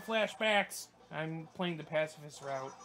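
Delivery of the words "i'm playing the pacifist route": usual speaking voice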